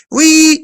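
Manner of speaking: sudden high, loud mocking whoop; high loud jeer at a man who lost his hair. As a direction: toss out a jeering high cry. Mocking whoop mid-rant.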